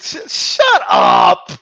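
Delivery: high, drawn-out exasperated shout; fed-up comic outburst. Acted. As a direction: cry out in disbelief, stretching it. Exasperated reply to mocked announcer.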